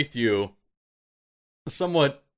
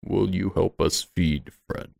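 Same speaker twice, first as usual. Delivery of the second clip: slow, low creature voice; creature from beneath; 5 semitones lower, slower than usual. Acted. Creature beneath asks the swimmer for help, low and slow. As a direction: ask it slowly from the depths. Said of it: "from beneath once again".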